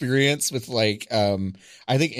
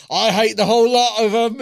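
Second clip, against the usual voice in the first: a gruff London cabbie grumbling; Cockney cabbie; 7 semitones higher, 5 dB louder than usual. Retold or imitated. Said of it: "And she's just like".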